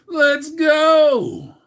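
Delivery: high, loud cry of excitement; hype shout. Real, not acted. Cheering the live chat, a loud high hype shout. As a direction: let it rip like a crowd cheer.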